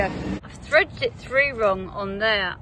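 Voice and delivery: slow, explaining as she points it out; "I've threaded it through wrong" said slowly, one word at a time; slow showing. Shown as a demonstration. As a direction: take it slowly, showing the mistake. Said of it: "oh, I'll show you".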